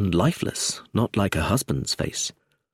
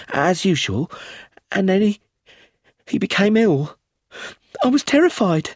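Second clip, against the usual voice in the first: frightened, stammering; 9 semitones higher than usual. A narrator's reading. Widow retelling the death to inspector, distraught. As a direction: relive the panic, stumbling.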